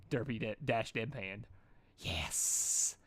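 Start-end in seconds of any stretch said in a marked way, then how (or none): 2.0-3.0 unvoiced hissed yes; low hiss of greed. A narrator's reading. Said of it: "hissed Derpy".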